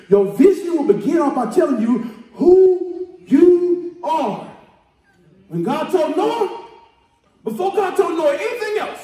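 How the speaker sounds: shouted, rousing sermon cadence; preaching at full force. Real, not acted. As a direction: preach it hard to the congregation.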